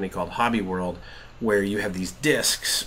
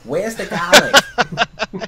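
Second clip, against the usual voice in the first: laughing; 11 semitones higher, 8 dB louder, much slower than usual. Real, not acted. Jokes about missing ingredient.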